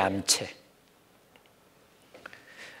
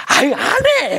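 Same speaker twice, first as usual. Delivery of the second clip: high, loud sore-loser outburst; acted tantrum; 13 semitones higher, 7 dB louder, slower than usual. Child losing a board game, mock angry outburst. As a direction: quit the game in a mock huff. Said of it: "자기 마음대로 안 되면".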